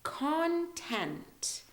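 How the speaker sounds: CONTENT slowly, high on CON; drawn-out "CON-tent"; stress on CON. Shown as a demonstration. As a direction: hit the first syllable of CONTENT and stretch it. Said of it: "more emphasis on the con".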